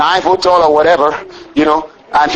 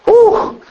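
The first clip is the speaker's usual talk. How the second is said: laughing whew. Real, not acted. Preacher delighting in the Lord's plan, laughing whew. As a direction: blow out a high, delighted whew.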